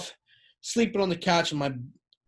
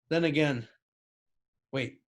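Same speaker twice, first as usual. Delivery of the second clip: ordinary talk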